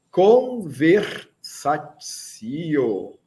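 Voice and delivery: "Conversatio" drawn out very slowly, each syllable stretched; slow announcement. Shown as a demonstration. Teacher announcing the conversation part to the class, very slow and drawn out. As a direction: stretch "Conversatio" out like a title card. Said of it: "vamos para a parte de conversação".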